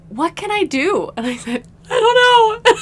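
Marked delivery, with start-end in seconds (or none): 0.1-1.1 raised-pitch call, lightly voiced; imitated calling up. Partner calls up the stairs to her, imitated calling out. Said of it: "he calls up to me". 1.9-2.7 high, loud mock wail; retold frantic yell. Overwhelmed woman answers her partner from upstairs, mock frantic yell.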